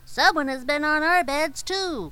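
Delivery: high, loud chorus of dwarfs; dwarfs crying out. A narrator's reading. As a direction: shout it up high, all together. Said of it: "came running and cried".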